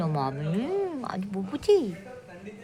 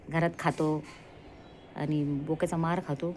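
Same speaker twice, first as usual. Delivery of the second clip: ordinary talk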